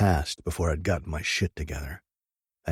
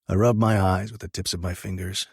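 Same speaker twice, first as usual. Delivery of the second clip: ordinary delivery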